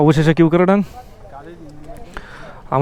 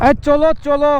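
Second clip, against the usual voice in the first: calling out; 8 semitones higher, 6 dB louder than usual. Real, not acted. Urging on the ride.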